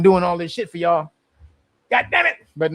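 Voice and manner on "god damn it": exasperated cry. Real, not acted. Griping about being stuck streaming.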